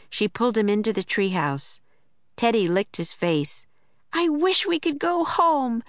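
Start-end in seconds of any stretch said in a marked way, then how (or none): 4.1-5.8 put-on high-pitched girl's wish; urgent cry, pitched well up; Annie shouting. A narrator's reading. Annie pointing at the book, high shout. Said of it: "shouted Annie".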